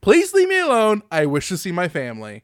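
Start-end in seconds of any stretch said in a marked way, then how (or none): 0.0-1.0 pleading voice. Pretend. Opening mock plea.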